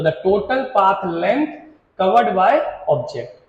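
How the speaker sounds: very slow, word by word, pause before the last word; slow dictation. Shown as a demonstration. Teacher dictating the definition to students, slow and spaced. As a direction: spell it out for notes, slowly.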